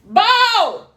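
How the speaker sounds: loud high call upstairs; yelling across the room. Real, not acted. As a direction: shout his name down the stairs. Calling husband from upstairs.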